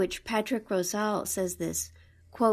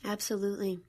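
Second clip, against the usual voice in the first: usual speaking voice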